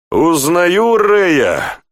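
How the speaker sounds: high, drawn-out exclamation; hearty delight at an old friend; amused. A narrator's reading. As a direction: crow it with amused recognition, without laughing. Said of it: "захохотал Тьерри".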